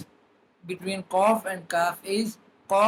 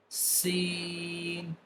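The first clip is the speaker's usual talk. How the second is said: usual speaking voice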